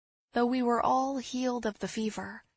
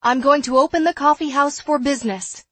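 loud declaration; 5 semitones higher, 12 dB louder than usual. Acted. Announces her decision firmly.

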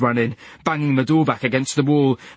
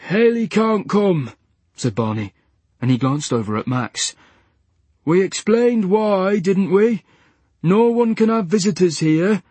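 higher, slow, patient; calm nurse; 6 semitones higher than usual. A narrator's reading. Nurse explaining to Leach, patient and slow.

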